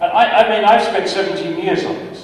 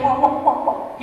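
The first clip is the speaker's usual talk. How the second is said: imitating a startled colleague, higher with a trailing laugh; mock stammering shock, pitched up; disturbed colleague; 10 semitones higher than usual. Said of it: "He was really, uh, disturbed by this".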